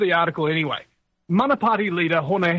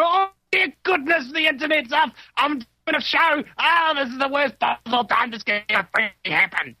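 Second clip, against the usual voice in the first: high, frantic self-quote; panicked rush of words; 6 semitones higher than usual. Retold or imitated. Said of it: "just going".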